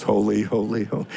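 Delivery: lightly mocking singsong. Imitating a listener's mindless singsong. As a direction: toss it off like a tune. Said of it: "that's the way the song goes".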